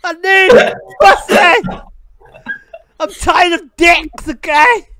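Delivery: loud, high comic rant; hammed-up desperate shout. Prankster ranting at a new stranger, mock-desperate shout. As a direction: yell it as a desperate, over-the-top plea.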